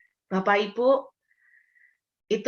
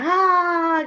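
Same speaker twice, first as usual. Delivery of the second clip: short high shout of release; scream into pillow; 11 semitones higher, 6 dB louder than usual. Shown as a demonstration. Preacher demonstrating her pillow scream, loud. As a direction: let out a sudden cry into a pillow. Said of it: "Di dalam bantal saya teriak".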